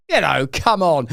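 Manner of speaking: normal talking voice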